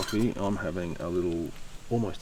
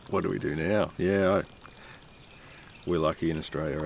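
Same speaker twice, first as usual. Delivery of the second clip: usual speaking voice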